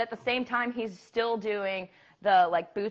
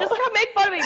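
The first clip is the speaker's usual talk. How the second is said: higher, louder laughing plea; 12 semitones higher, 5 dB louder than usual. Real, not acted. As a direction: beg through a laugh, higher. Repeats it as a pleading plea.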